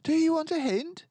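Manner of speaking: teasing. A narrator's reading. Boris teasing his cub mates, playful. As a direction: dangle the hint playfully.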